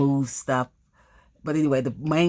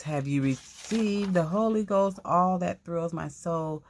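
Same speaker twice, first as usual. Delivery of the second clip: reading aloud; 5 semitones higher than usual. Acted. Reading the old booklet aloud.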